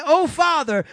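loud, high mock-formal Oh Father; mock-formal child greeting a parent; stiff formal address. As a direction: ham up a stiff, proper child. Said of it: "My children do not walk in and say".

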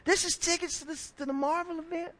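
put-on high, astonished little boy's voice; a small boy who can't believe his luck; amazed child. Boy amazed at Marvel tickets, high astonished child voice.

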